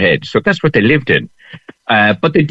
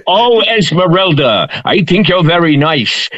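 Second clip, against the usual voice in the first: higher, slow love-poem recitation; mock poem recital; 7 semitones higher than usual. Reciting a teenage love poem on request, playful recital. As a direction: recite it like a schoolboy's love verse. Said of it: "Give us one of the poems".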